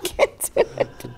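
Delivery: laughing through the words; laughing too hard to go on; real laughing. Real, not acted. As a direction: give up, cracking up. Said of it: "Is my laugh British enough".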